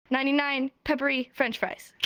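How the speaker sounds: brisk run-through in one go; quick tongue twister. Shown as a demonstration. Teacher shows students the finished tongue twister, rattled off briskly. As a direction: rattle off the whole phrase as a show-off. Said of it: "Watch this".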